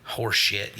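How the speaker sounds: normal talking voice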